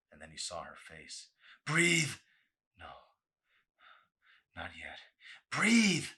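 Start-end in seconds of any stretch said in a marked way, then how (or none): 1.7-2.2 loud, much higher urgent cry. Acted. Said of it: "Arson cried". 2.8-5.0 soft, pleading whisper; desperate whisper. Acted. Desperate refusal under water. 5.5-6.1 loud, much higher urgent cry. Acted. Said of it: "Arson cried".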